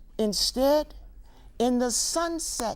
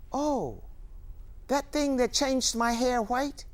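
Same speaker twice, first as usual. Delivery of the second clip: normal talking voice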